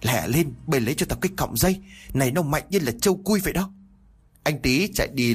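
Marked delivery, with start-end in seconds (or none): 0.0-3.7 higher, faster urgent order; calling out. A narrator's reading. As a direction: rush the words out, pitch climbing. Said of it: "thì để nói lớn". (giọng hô gấp, cao dần)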